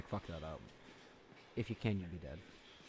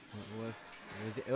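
ordinary talk

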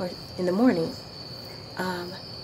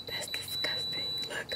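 gasp, then a breathless whispered that's disgusting; disgusted whisper; 18 dB quieter than usual. Real, not acted. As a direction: recoil in a whisper.

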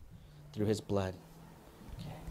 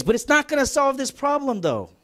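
raised voice; 6 semitones higher than usual. Real, not acted. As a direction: voice rising high in frustration. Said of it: "I'm sorry if I raised my voice there".

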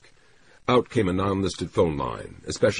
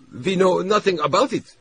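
high, nervous flustered denial; a scared flunky blurting; sputtering anxiously; 5 dB louder than usual. A narrator's reading. SD flunky denies everything to Tagomi, sputtering. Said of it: "sputtering anxiously".

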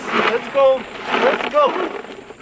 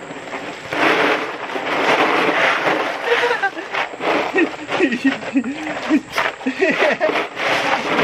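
long breathy laugh; 7 semitones higher than usual. Real, not acted. Watching dogs in ice.

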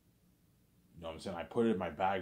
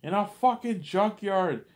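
high, loud screaming rage; father disowning the boy, screaming high and loud; furious dad; 12 semitones higher, 8 dB louder than usual. Retold or imitated. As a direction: scream it down at the son like a raging father. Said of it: "He's like".

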